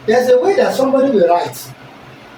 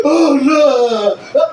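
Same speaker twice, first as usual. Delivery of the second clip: emotional cry; 6 semitones higher, 4 dB louder than usual. Shown as a demonstration. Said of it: "The person is having emotion".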